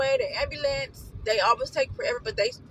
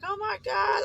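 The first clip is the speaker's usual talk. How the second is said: shocked cry; 8 semitones higher than usual. Retold or imitated. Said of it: "my sister was just like".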